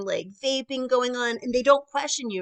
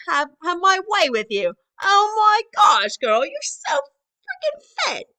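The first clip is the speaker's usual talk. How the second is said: loud, high-pitched hammed-up British accent; 8 semitones higher, 7 dB louder than usual. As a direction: go full mock-British, louder and higher, stretching the last exclamation. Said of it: "talking in, like, a British accent".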